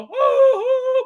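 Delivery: very high, loud falsetto woo-hoo; hammed-up falsetto whooping; mock high whoop. Mimics elves standing in formation.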